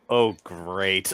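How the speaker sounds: low, soft deadpan groan of dismay; weary, unimpressed aside; eye-rolling sarcasm. Acted. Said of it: "blink, roll my eyes".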